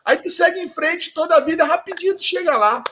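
high, sing-song carioca direction-giving; helpful local carioca. Retold or imitated. Kiosk guy sends tourists toward the beach, mock-helpful high voice. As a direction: imitate an over-helpful local, pitched well up. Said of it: "Aí ele assim".

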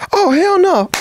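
mom's outraged cry. Retold or imitated. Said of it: "the first take".

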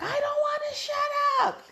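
put-on high squealing kid voice, very high; screaming little kid. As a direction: squeal it like a tantrum child. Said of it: "the little boy was like".

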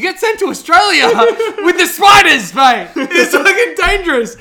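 high, very loud outburst through laughter; yelled, high-pitched comic rant; laughing shout. Real, not acted. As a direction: shout it excitedly, cracking up. Animated rant about being sent to Australia.

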